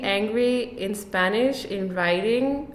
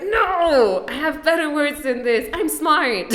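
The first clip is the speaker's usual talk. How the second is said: high-pitched mock-frustrated protest; 6 semitones higher, 4 dB louder than usual. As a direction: protest in a high, exasperated voice, breaking into a laugh. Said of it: "I get so frustrated".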